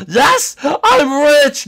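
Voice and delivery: high, loud cheer of joy; excited cheer. Acted. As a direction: shout like you just won. Thinks he's rich.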